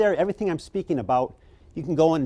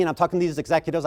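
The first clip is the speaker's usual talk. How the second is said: usual speaking voice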